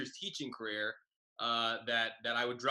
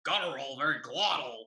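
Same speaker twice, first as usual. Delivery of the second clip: loud, guttural teacher impression, pitched up; glottal, raised-voice teacher; 4 semitones higher, 4 dB louder than usual. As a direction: imitate her very glottal voice. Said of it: "she sounded like this, very guttural, very glottal".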